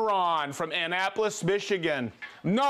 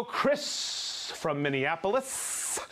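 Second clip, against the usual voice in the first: slow, with long trailing s sounds; rolling drawn-out delivery; 4 dB quieter, much slower than usual. Shown as a demonstration. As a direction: let Chris and Minneapolis trail off in a long s. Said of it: "That has a roll".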